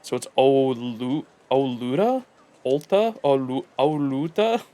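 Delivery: slowly sounding out Olu, Oluda, Olta; sounding out the name. Shown as a demonstration. Working out the R-to-L swap, slowly sounding out the name. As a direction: stumble through the spellings, puzzled and half laughing. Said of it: "The R equals an L?".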